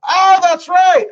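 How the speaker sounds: high, loud, drawn-out "oh" of remembering; sudden realization. Real, not acted. Streamer reminded of the link below, sudden loud realization. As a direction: exclaim as it suddenly comes back to you.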